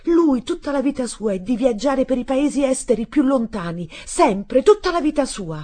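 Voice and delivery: high, loud, impassioned protest; crying out. A narrator's reading. Boy defending his father's travels, shouting. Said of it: "io gridai".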